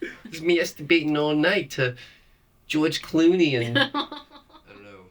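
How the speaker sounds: high, slow giggly confession; laughing mock confession. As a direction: giggle through a silly confession. Joking voice, laughing.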